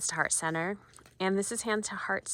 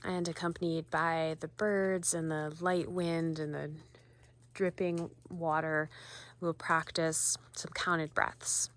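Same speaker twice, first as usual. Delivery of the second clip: guided meditation. Acted. Volunteer guiding viewers to ground themselves, calm meditation voice. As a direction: ease listeners into noticing their feet.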